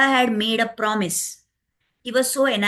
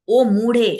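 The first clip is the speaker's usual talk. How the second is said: louder, sharp call to wake the queen; a scolding cry of fool; 6 dB louder than usual. Acted. Manthara scolding the sleeping queen.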